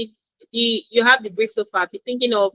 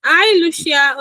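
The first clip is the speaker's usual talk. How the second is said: calling out, raised and louder; to someone hard of hearing; 7 semitones higher, 5 dB louder, slower than usual. Real, not acted. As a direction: call her name across the room. Calling to a late, silent student.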